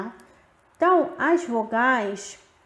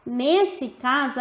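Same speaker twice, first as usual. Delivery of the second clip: usual speaking voice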